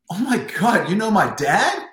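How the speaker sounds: high, loud, gushing mock surprise; mock amazement. Swimmer answering his rival's question about his dad, hammed-up amazement. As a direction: exclaim it as if thrilled and astonished. Acting out his reply to Popov.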